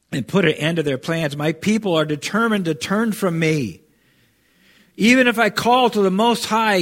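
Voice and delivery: preacher's rising scripture read; preached scripture. Acted. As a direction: proclaim the prophecy, building to a high, loud top. Preacher reads prophecy aloud.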